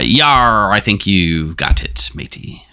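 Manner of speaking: a light pirate growl, slowed down; pirate yar. Acted. Game master slips into pirate speak for the captain, lightly. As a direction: toss off a quick pirate yar. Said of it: "you don't wanna break character".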